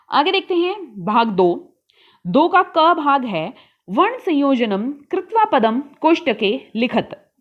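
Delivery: normal talking voice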